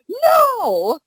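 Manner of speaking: squeaky high no, stop it; high-pitched protest. Retold or imitated. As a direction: pitch it way up, pleading. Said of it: "I'm like".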